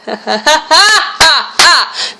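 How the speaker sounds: short high laugh at the absurdity; a real incredulous chuckle; disbelieving laugh. Real, not acted. Laughing at the woman's claim.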